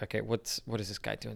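hushed aside. Retold or imitated. Marcus to the narrator about the stranger, hushed aside. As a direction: keep it down so the guy won't hear. Marcus reacts to intruding guy.

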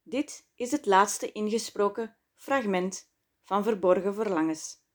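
ordinary delivery, nothing marked

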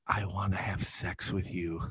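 soft, slow whispered line; hushed seductive mock whisper; whisper for effect. Shown as a demonstration. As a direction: breathe it into an ear, slowly. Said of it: "I'm gonna whisper it for the effect".

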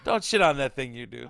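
ordinary talk, nothing marked

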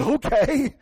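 high, loud mock-exasperated okay; impatient. Man sent to women's restroom, impatient loud reply. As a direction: blurt it out with comic impatience. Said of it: "I was impatient".

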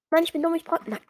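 normal talking voice

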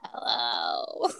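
very low, husky mock hello; imitating a congested deep voice; deep voice impression. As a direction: growl hello like rising from the dead. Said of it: "that deep voice".

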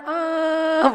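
loud, high fake wail; mock bawling. Man texting apology to his ustadz, mock bawling. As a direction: burst into a hammed-up cry. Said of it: "nangis juga aneh kan".

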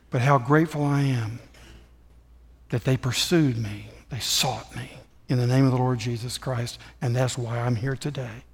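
low, soft sermon testimony; earnest preaching. Real, not acted. Preacher telling congregation how he was sought, low and earnest.

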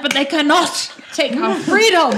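loud, high Scottish battle cry; hammed-up Scottish rallying shout; Scottish accent. Player jokingly helps another find the accent, loud Scottish accent. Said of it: "I need to find my Scottish accent".

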